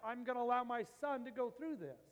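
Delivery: loud, high, full-force preacher cry; impassioned preaching. Acted.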